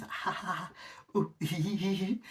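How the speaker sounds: high, soft tittering ha-ha and hee-hee; mock self-amused giggle. Mocking Ricky giggling at his own bad joke, high tittering. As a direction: imitate a comic laughing at his own punchline. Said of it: "he's laughing too much at his own joke".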